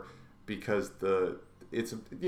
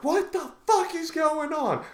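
much higher, incredulous squeal; bewildered passengers. Retold or imitated. Passengers reacting to the thrown Pop-Tart, high and incredulous. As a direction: sound like stunned riders who can't believe it. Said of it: "are like".

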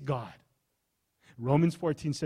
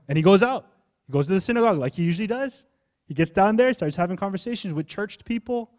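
louder, higher, driving sermon narration; energetic preaching; 6 semitones higher, 7 dB louder than usual. Acted. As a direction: push the story forward with fire.

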